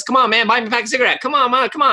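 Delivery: high, fast pushy begging; pleading beggar voice. Retold or imitated. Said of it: "He goes".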